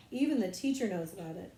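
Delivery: ordinary delivery, nothing marked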